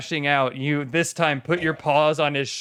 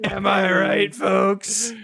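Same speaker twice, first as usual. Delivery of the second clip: showman voice; 6 semitones higher, much slower than usual. Acted. Showman joke to the table.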